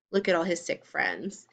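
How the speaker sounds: ordinary delivery, nothing marked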